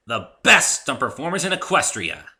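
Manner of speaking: high and loud, bragging; boastful pegasus. A narrator's reading. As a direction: shout it like a fan. Rainbow Dash boasting.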